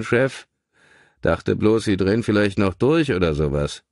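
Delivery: a hint of a gruff cab driver; lightly voiced cabbie. A narrator's reading. Taxi driver asking his passenger the house number. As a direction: play the chatty taxi driver.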